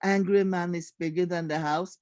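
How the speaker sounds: plain reading voice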